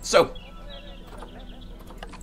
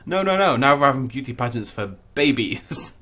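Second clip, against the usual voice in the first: normal talking voice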